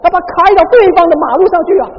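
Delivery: loud, high and fast alarm; alarmed exclaim. Retold or imitated. Preacher reliving car drifting into wrong lane, fast alarmed cry. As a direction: blurt it out in a rush of panic. Driver swerves into oncoming lane.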